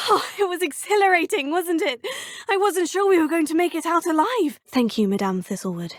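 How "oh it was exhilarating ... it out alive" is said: giddy, laughing relief; breathless laughing thrill. Acted. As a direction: laugh it out, high and loud. Laughing after a narrow escape.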